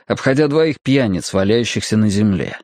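ordinary delivery

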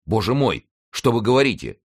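high-pitched dismay; alarmed exclamation. A narrator's reading. Youth dismayed at where his guardian is, alarmed. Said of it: "воскликнул Рауль".

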